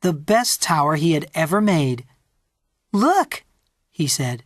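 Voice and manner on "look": put-on very high little-boy voice, rising; proud child's call. A narrator's reading. Peter showing Mommy his tall tower, proudly eager.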